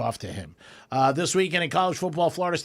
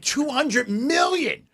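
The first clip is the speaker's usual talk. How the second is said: outraged shout; 10 semitones higher, slower than usual. Real, not acted. Outraged at the salary.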